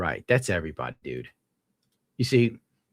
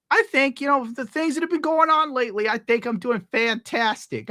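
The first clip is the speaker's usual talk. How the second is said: sarcastic; 14 semitones higher than usual. Real, not acted. Sarcastic claim of doing well.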